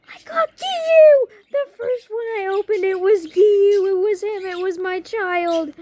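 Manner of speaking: very high, very loud, stretched-out squeal; freaking out. Retold or imitated. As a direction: scream the news with joy. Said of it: "I start freaking out".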